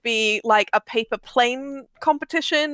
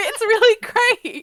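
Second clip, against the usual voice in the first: laughing through it, higher and slower; 11 semitones higher, 4 dB louder, slower than usual. Real, not acted. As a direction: gush about it while laughing. Gushing about the podcast.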